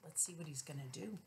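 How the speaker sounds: gentle hushed comment; soft aside. Real, not acted. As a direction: quietly point out the picture. Aside to child.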